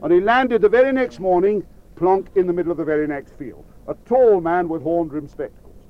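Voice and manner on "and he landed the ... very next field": plain reading voice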